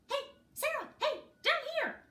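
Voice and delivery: very high, tiny squeaky voice; tiny squeaky ant. A narrator's reading. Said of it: "tiniest, squeakiest of voices say".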